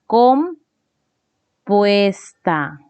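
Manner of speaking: "compuesta" stretched out with a pause after "com"; slow dictation. Shown as a demonstration. Dictating while writing the label.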